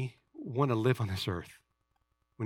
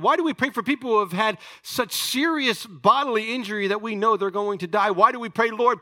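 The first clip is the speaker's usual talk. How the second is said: rising, louder exhortation to the church; full-force preaching; 8 semitones higher, 5 dB louder than usual. Acted. Preacher urging his congregation to live the truth, full-force preaching. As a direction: preach it hard, pushing the congregation to act. Rhetorical questions building to climax.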